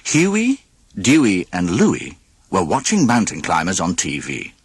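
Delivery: usual speaking voice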